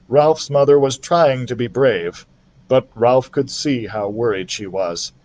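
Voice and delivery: usual speaking voice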